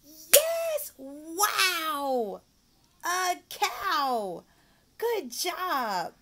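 high loud praise; hammed-up excitement. Shown as a demonstration. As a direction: cheer like the kid won. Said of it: "praise demo".

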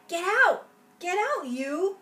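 shooing shout. Acted. Shooing someone away.

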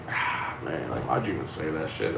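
low muttered regret. Retold or imitated. Rereading his own posts, a low ashamed mutter. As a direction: groan it under your breath, ashamed of what you posted.

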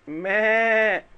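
long, very slow, high bleating ماء; playful goat impression. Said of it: "عايز حد يقلد صوت المعزة".

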